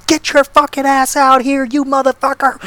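high mock yell at the trailer door; retold scream. Drunk girl pounding on trailer door, mild mock scream.

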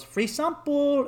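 high, slow sales pitch; coaxing street dealer. Retold or imitated. Street dealer offers the new arrivals drugs, high coaxing voice. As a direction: imitate a Colombian dealer luring a tourist.

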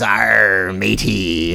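lightly growled Hollywood pirate "Arr, matey"; a hint of movie pirate; mock pirate accent. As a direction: toss off a stock pirate voice. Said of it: "the Hollywood pirates are always".

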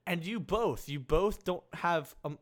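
ordinary talk, nothing marked

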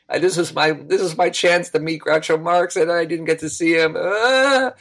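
high whiny wail, climbing at the end; mock crying. Boy who missed meeting Groucho Marx, mock-crying tantrum. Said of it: "I'm on the floor crying".

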